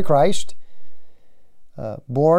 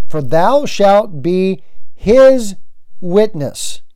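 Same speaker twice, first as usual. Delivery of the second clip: slow, louder, each word weighed; solemn proclamation; 3 semitones higher, 4 dB louder, much slower than usual. Acted. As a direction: proclaim it like scripture, slowly. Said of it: "Jesus said to him".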